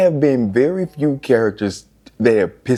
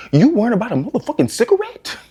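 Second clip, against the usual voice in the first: much higher, faster scolding; exasperated; 12 semitones higher, faster than usual. Acted. Scolding Ethan's sister over her cigarette: high and exasperated. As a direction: hurl the question at her.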